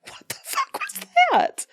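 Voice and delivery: high-pitched, incredulous reaction; mock disbelief. Storyteller reacts to the man's sudden cries, high disbelief. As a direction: act out being utterly baffled, pitch jumping up. Retelling her shocked reaction.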